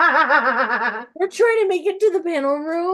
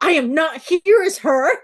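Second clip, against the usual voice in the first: flustered denial; 4 semitones higher, 6 dB louder than usual. Retold or imitated. Flustered denial of pen name.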